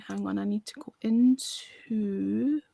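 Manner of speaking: whispered under breath. Real, not acted. Host searching files, whispering to herself. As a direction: mutter it half to yourself. Muttering to self while searching.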